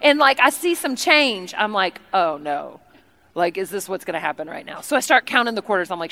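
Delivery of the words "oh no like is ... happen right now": low, soft, sinking dismay; mock dismay. Guest sees only change in purse, low mock dismay. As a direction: let the voice sink with dread.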